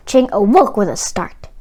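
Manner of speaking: ordinary delivery, nothing marked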